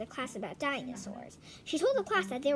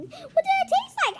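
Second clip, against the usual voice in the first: eager question; 10 dB louder than usual. Acted. Kid eagerly asking.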